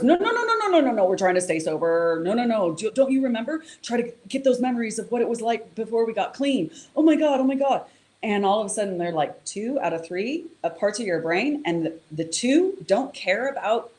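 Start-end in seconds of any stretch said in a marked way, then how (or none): 0.0-7.8 the prefrontal cortex begging him to stay sober, frantic; frantic refusal. Retold or imitated. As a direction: panic and beg it to stop. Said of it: "the prefrontal cortex goes".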